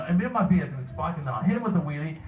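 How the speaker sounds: slightly high, badly faked British; bad British accent. Fan repeating a sketch line to the panel, bad British accent. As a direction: put on an amateur British sketch voice. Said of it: "bad British accent".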